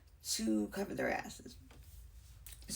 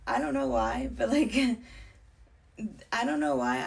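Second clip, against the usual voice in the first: louder, laughing through it; 4 semitones higher, 7 dB louder than usual. Real, not acted. Telling the story and laughing at her own words. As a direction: laugh at the memory.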